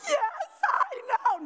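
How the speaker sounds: high, drawn-out imitation of crying; mock sobbing. Answering the praying stranger, mock ugly cry. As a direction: wail it slowly in a hammed-up cry. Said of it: "I've got the ugly cry going. I'm sobbing hysterically".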